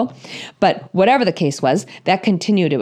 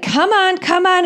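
much higher, pleading coax; higher eager hurry-up to a horse; urging a horse; 11 semitones higher than usual. Retold or imitated. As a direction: get the pony back on the trail. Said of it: "and I'm like".

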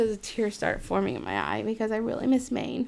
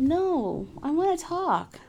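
higher, eager voice of a client; lightly voiced insistent client; 8 semitones higher than usual. Therapist imitating her client insisting he wants to talk. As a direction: act out the client begging to talk.

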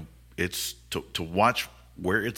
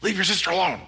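high, loud parental yell; 11 semitones higher, 5 dB louder, faster than usual. Retold or imitated. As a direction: shout back over your shoulder. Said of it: "turn their head over their shoulder and yell at us".

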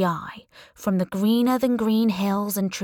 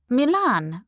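higher, louder put-on Italian accent; mock Italian accent; 5 semitones higher, 5 dB louder than usual. A narrator's reading. Heroine bluffing about her origins, mock Italian accent. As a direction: play an Italian giving her hometown. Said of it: "in my mock Italian accent".

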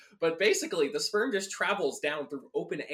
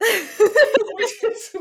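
laughing; 13 dB louder than usual. Real, not acted. Laughing through a callback joke.

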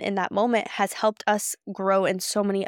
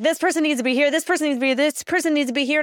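tough voice; 5 semitones higher, faster than usual. Retold or imitated. Retelling herself ordering event staff, tough commanding voice. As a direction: rattle off assignments like a strict little karate boss. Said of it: "bring out my tough voice".

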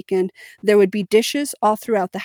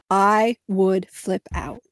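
ordinary talk

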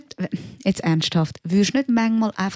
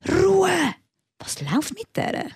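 high-pitched mock yell, imitating an irate passenger; high, drawn-out indignant outburst; retold shout; 12 semitones higher, slower than usual. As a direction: act out someone yelling for silence across a train compartment. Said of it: "dann schreit einfach irgendeiner im Abteil".

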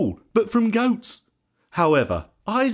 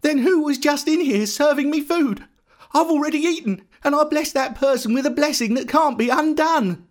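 high-pitched, agitated alarm; trembling; 12 semitones higher than usual. A narrator's reading. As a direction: panic as the truth sinks in. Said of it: "Isaac began trembling uncontrollably".

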